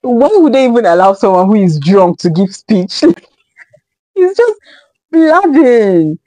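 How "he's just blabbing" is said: laughing out the words, pitch jumping up; giggling while calling it blabbing. Real, not acted. Vlogger laughing at the drunk best man's rambling.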